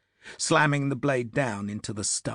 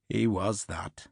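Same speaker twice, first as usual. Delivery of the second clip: put-on Irish accent; 2 semitones lower than usual. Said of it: "spoke in an Irish accent".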